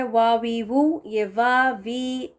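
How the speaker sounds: वा वी वू drawn out, rounded lips; slow recitation. Shown as a demonstration. Teacher recaps the three vowel sounds slowly. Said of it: "वाव ज़बर ज़ेर पेश के साथ है".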